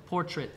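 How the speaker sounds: "portrait" ending in a short -trit or -tret; short-vowel demonstration. Shown as a demonstration. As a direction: say "portrait" with the A swallowed. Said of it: "una I, eh, corta".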